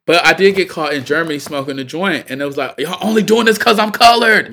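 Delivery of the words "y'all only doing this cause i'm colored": higher, louder, outraged voice; mock indignant protest. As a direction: protest like you're wronged, hammed up. Retold protest to German police.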